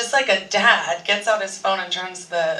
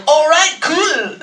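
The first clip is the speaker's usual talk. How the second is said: higher, louder casual dude voice; matching fan energy; 7 semitones higher, 6 dB louder, slower than usual. Retold or imitated. Actor answering a fan, loud and higher. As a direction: throw it back with the fan's energy. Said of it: "he responds the same energy".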